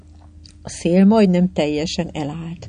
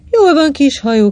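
higher, louder cry of praise; cheering cry, pitched up; joyful shout; 8 semitones higher, 6 dB louder than usual. A narrator's reading. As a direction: call out "well done" to a little boat. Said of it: "kiáltotta Titti".

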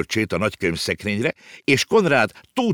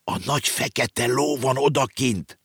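slow, raised-pitch awe; hushed alarm of a startled boy; pale with fright; 7 semitones higher than usual. A narrator's reading. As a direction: report it slowly, as if stunned. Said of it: "sápadtan jött vissza".

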